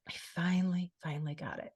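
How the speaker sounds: overcome, words fading away softly; real emotion, fading into a whisper; getting emotional. Real, not acted. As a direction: voice drops to a choked near-whisper. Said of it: "I'm getting a little emotional".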